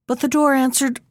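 plain reading voice

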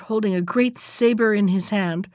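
plain reading voice

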